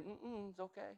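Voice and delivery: soft, low, serene quoting of scripture; calm church voice. Pretend. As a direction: soothe like the church amid the horror. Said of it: "here comes the church saying".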